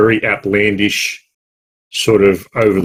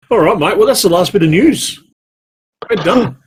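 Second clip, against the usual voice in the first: excited; 10 semitones higher than usual. Real, not acted. Podcast wrap-up announcement.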